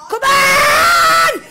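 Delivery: will the car on; urging shout. Real, not acted. Gamer urging his car, loud high shout.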